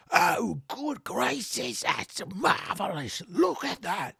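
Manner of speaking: high, drawn-out exclaiming; marvelling trainer. Acted. Trainer Elad amazed by the second hit, delighted. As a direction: gush in a high put-on voice, loud at first.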